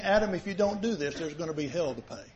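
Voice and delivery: hushed grave threat; quiet menacing warning. Acted. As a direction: drop to a low threat. God warning Adam, imagined.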